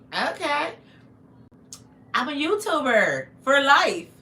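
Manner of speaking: loud, drawn-out proud proclamation; retold comeback, belted out; emphatic declaration. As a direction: announce it big, slow and high-pitched. Retold defiant reply to notifications.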